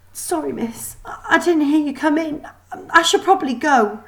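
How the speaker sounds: high, shaky-sounding robot maid voice; a sniffling servant caught crying; the maid apologizes, upset and flustered; tearful mechanical maid. Acted.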